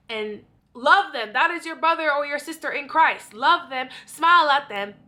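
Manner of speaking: fired-up sermon-style order to love them; loud preaching. Real, not acted. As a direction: preach it hard at the congregation. Preaching to be kind in church.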